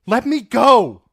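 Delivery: high, loud protest; struggling cry. Acted. Dany to Sir Barristan holding her: high, loud cry. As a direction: shout to break free.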